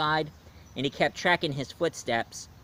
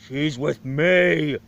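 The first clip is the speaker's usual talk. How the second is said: slow, louder monster claim; possessive monster; 5 dB louder, much slower than usual. Retold or imitated. Monster claims the bride from Frankenstein, slow and loud. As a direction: drag each word out like a jealous monster. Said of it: "And the monster's like".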